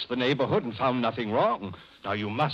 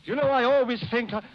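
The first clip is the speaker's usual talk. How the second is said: rushed, much higher, voice running away; frantic; 6 semitones higher than usual. Acted. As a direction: panic breaking through the calm act. Murderer cracking under guilt.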